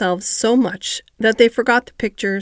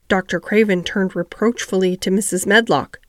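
plain reading voice

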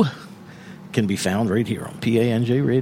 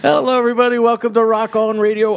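radio host intro; 8 semitones higher, faster than usual. Acted. Host opens show.

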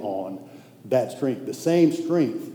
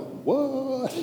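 high, soft, thrown-off "what"; a quietly startled "what"; stunned what; 11 semitones higher, 5 dB quieter than usual. Retold or imitated. The preacher hearing a friend's complaint, a high stunned what. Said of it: "part of me was like".

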